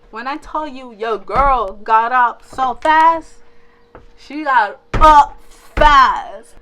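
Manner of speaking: loud, slowed, dramatic emphasis on fast; panicked exclamation. Real, not acted. Storyteller to viewers, loud dramatic emphasis. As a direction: hammer home how fast she jumped up. Panicked rush recalling waking.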